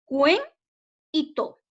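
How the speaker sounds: "cuen" said on its own with a rise, a pause, then "to"; split into syllables. Shown as a demonstration. Teacher splitting the word for her students, syllable by syllable. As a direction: pull cuento apart into two syllables with a break between them. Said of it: "que está dividida en dos sílabas".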